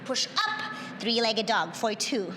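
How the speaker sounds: ordinary delivery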